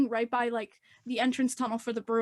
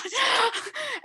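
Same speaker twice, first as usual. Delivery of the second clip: high laugh; 11 semitones higher than usual. Real, not acted. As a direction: laugh out shrieking. Laughing after shock.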